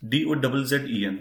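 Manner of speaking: usual speaking voice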